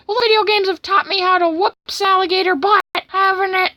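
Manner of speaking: high, loud boasting character voice; hammed-up swaggering monster; cocky cartoon villain.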